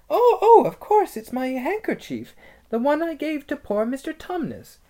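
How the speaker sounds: put-on high, loud girlish delight; excited girl. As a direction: gasp with sudden recognition. Lucy recognising handkerchief.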